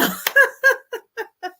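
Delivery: sudden laugh at the viewer's comment; loud high laugh. Real, not acted. Laughing after reading comment.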